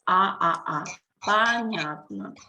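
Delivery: "А, а, а" as separate ah sounds, then "Понятно" with the same vowels; every vowel in "Понятно" read as ah, including both written O's. Shown as a demonstration. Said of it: "So you read all the vowels here".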